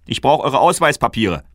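high, loud brusque "Ausweispapiere"; guard demanding the kings' papers, loud and brusque; gruff guard demand. Acted. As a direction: demand "Ausweispapiere" loudly, like an official.

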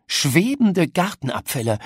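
usual speaking voice

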